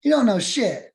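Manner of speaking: put-on sassy child talking back; bratty kid. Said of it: "And I was like".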